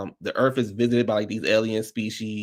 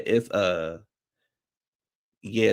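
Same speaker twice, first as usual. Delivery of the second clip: ordinary talk, nothing marked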